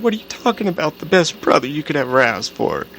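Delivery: close to tears. Retold or imitated. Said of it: "She's starting to cry".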